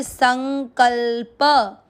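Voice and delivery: संकल्प very slowly, each syllable apart. Shown as a demonstration. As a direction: spell out संकल्प. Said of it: "संकल्प में अ mix करेंगे". (संकल्प बहुत धीरे-धीरे)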